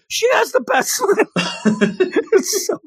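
high squealing gush breaking into laughter; over-the-top coach gush. Retold or imitated. Recapping the coach raving over a skater's smile, high laughing squeal. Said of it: "he starts yelling".

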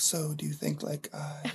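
hushed mock whisper of a quiet actor; imitated whisper. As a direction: whisper it like a shy British co-star. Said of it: "talking like this all the time".

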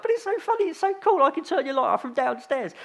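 squeaky high, playful protest; mock-innocent high-pitched excuse; gleeful pleading. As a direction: plead your silly case in a high voice. Himself pleading gleeful excuse to child.

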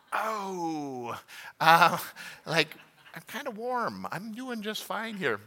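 high, lazy, teasing brush-off; mock smug refusal. Husband in bed refusing wife's water request, teasing and smug. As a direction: play the comfy husband who won't budge.

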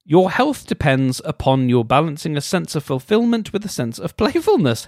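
mock-earnest reading; reading aloud. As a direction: read it like a horoscope entry.

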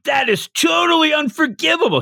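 very high-pitched theatrical indignation; fired-up mock outrage. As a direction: overplay righteous anger like a ham actor. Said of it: "She's getting fired up".

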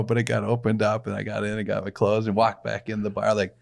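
usual speaking voice

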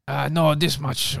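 slow, lightly put-on market seller accent, not a full Cambodian accent; vendor accent. Retelling a Cambodian market haggle, lightly mimicking the vendor's accent. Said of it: "Don't do accents".

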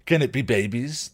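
hopeful troll. Acted. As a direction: ask it like an eager child. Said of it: "the troll asked hopefully".

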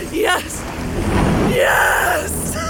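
high, frenzied cries of triumph; crazed exultant yell; ecstatic shouting. Acted. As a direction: shout it twice, the second wilder. Mad scientist exults.

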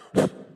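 sharp burst of rushing air; quick, loud sniff-like whoosh; sudden whoosh. Retold or imitated. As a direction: imitate light being sucked away. Imitates sunlight suddenly leaving.

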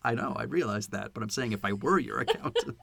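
low, soft muttered insistence; quiet last word. Retold or imitated. Imitating an accountant getting the last word, quietly. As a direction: back down but sneak the point in. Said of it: "quietly finishes".

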